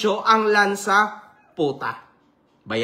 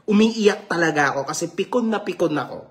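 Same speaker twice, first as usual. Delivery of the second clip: ordinary delivery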